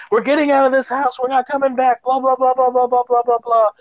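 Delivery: high, quick put-on woman's voice; hammed-up urgent refusal; mock frantic insistence. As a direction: rattle it off high, dissolving into blahs. Acted quote of woman fleeing house.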